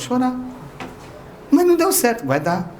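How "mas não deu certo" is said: much higher, pleading whine; whiny complaining believer. Retold or imitated. Believer complaining to Irmão Paulo after his talk, high pleading whine. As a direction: protest like a hurt, needy follower.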